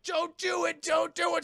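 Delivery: high, loud desperate plea; high loud begging yell; pleading shout. Retold or imitated. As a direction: beg him loudly to stop. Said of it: "And Clark's like".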